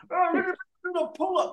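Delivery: high, fast, smug mock voice; mocking Vivek. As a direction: squeak it out like a show-off gym bro. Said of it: "he's doing".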